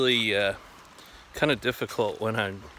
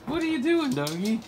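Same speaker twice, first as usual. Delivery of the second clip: talking to a dog; 13 semitones higher than usual. Real, not acted. Repeated baby talk to dog.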